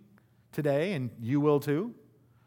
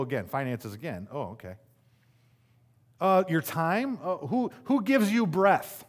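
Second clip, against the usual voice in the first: ordinary talk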